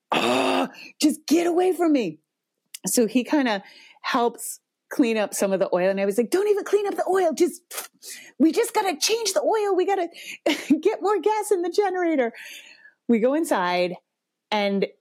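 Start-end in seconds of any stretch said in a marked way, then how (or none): 0.1-2.2 exasperated groan rising to a high snap; retold parental rage. Mom pushing her son away, enraged. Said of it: "like, parental rage". 6.3-12.3 high, exasperated rush of orders; retold parental rage. Mom ordering her son to leave the oil, fed up. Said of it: "like, parental rage".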